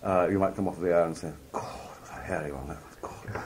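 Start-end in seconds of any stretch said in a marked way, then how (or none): 1.5-3.4 soft, whispery exhausted gasp; off-air relief. Acted. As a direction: let it out under your breath, drained. Acted relief on coming off air.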